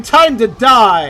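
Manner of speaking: loud, very drawn-out threat; mock-menacing shout, dragged out; threatening line delivery. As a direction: stretch it slowly into a hammy battle cry. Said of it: "say the line".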